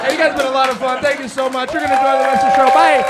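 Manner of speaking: high, loud thanks to the crowd, building; shouted sign-off. Real, not acted. Comedian thanks crowd at end of set, shouted sign-off. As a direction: build to a big shouted goodbye. Comedian signing off over applause.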